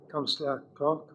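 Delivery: ordinary talk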